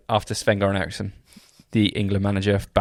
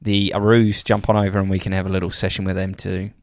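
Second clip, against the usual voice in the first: Australian accent, easing down towards the end; broad Aussie impression; 4 dB louder than usual. Shown as a demonstration. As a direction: put on an Aussie accent, starting loud.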